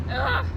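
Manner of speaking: loud high exasperated ugh; frustrated groan. Real, not acted. Frustrated about failing tests.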